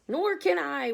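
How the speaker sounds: high, loud wail. Acted. Glowworm wails to the others she can't swim, high and loud. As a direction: wail in despair. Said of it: "wailed the glowworm".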